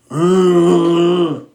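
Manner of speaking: pained mock whimper; a loud high whine of protest; high whiny groan. Creator scolding viewers' habits, with a high whiny groan.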